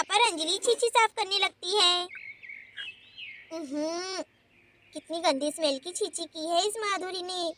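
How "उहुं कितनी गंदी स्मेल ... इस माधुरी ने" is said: soft, lower grunt of disgust under the breath. Acted. Anjali cleans up the mess in the yard, softly disgusted. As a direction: recoil from the smell, quietly revolted.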